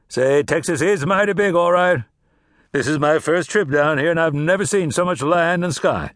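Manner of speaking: genial, showy travelling salesman; talkative drummer. Acted. Drummer making small talk with Cass about the heat. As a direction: chat like a friendly peddler.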